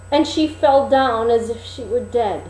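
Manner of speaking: ordinary delivery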